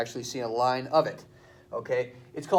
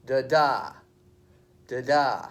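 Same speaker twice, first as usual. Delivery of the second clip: second DA hit louder in "Da da"; stress on second da; 6 dB louder, slower than usual. Shown as a demonstration. Teacher shows students the iamb beat, stress on second da. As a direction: punch the second "da" of "Da da". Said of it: "goes like this".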